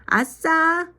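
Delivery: high, loud gleeful cheer; shout of joy. A narrator's reading. Girl told she can quit piano, shouting for joy. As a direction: yell it out like a thrilled kid. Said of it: "은지는 자기도 모르게 소리를 질렀습니다".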